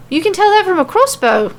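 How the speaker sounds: high incredulous question in a light Irish lilt; can't believe it, pitch jumps up; in disbelief. Acted. Becky doubts Nikki's guess, disbelieving Irish voice. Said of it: "Becky asks in disbelief".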